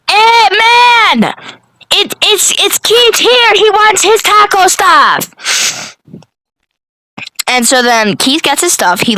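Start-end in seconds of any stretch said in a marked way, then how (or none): 0.1-6.0 mock yell. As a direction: holler toward the kitchen, pitched way up. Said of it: "he yells to this guy".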